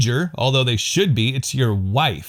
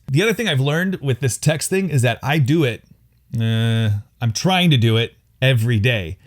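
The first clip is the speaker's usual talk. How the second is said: ordinary talk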